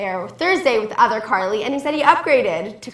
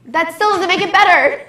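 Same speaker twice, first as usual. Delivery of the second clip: indignant protest; 7 semitones higher, 7 dB louder than usual. Real, not acted. Co-host playfully offended by upgrade remark.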